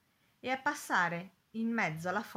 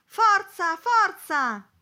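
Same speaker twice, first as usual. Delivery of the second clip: high, loud yell of encouragement; crowd's cheering shout; 12 semitones higher, 12 dB louder, much slower than usual. A narrator's reading. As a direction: cheer a swimmer on from the boat. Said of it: "passeggeri e turisti gridavano".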